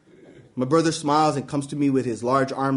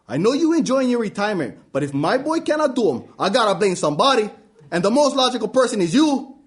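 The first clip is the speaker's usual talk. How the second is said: blaming dad; 6 semitones higher, 3 dB louder than usual. Acted.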